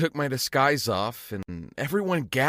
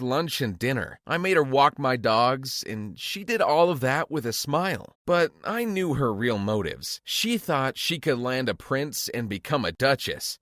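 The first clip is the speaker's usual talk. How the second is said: plain reading voice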